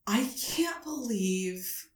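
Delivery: slow, high, helpless pleading; playing dumb, near tears. Caller playing clueless to Amazon about a ban, mock-tearful. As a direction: act like you're about to cry, drawn out. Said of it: "Did you act like you were gonna cry?".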